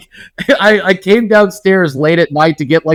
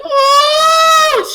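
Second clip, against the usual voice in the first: imitated mother's very high wail; upset high-pitched wail. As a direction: cry out in a put-on falsetto. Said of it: "She was, like, very upset".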